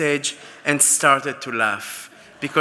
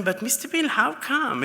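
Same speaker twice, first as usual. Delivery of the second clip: much higher-pitched, baffled question; puzzled questioning; 10 semitones higher than usual. Retold or imitated. Assistant asking his boss why, puzzled. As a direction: ask it in high, retold bewilderment. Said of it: "And I asked him".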